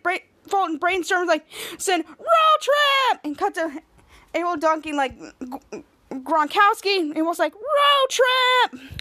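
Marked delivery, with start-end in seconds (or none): 2.1-3.2 high, excited retold shout; excited cheer. Team all getting so excited, high cheer. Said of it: "getting so excited". 7.5-8.9 high, drawn-out cheering cry; mock whoop, pitched way up; excited cheer. Teammate echoing the road trip idea, excited cheer.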